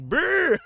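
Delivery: high, loud rolled brr like a gun; playful imitated gunfire; mock gunfire brr. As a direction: trill out gunshots, high and loud.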